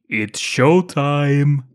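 very slow, loud put-on Austrian accent; hammed-up Austrian action-hero one-liner; Arnie. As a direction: drag out a bad Austrian accent. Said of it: "Is that your accent?".

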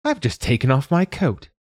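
a hint of a fussy old lady; mild complaint, lightly voiced; protesting old woman. A narrator's reading. Old woman objecting to the open window, mildly voiced. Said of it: "an old woman protested".

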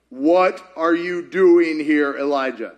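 slow, low, measured questioning; voice of God. Acted. God questioning Elijah at the cave, slow and solemn. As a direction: ask slowly, weighting each word. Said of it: "the voice says".

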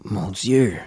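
ordinary talk, nothing marked

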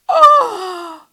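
loud, high "oh"; triumphant whoop. Real, not acted. Host celebrates her own advice, triumphant exclamation. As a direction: exclaim like landing a knockout point.

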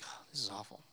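breathy over-the-top whisper; dramatic whisper. Retold or imitated. Jonah melodramatic about the heat, whispered. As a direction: moan it like a martyr, in a whisper. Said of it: "He's like".

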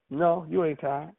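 a hint of mama refusing to hear it; mama's voice. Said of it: "in my mama's voice".

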